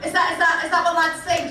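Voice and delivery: ordinary delivery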